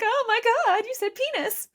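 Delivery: high scandalized mom voice; high mock-shocked mom; imitating mom. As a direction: gasp like a shocked mother. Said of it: "My mom's probably like".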